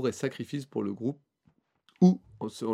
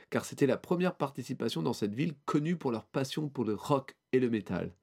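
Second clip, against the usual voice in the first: ordinary delivery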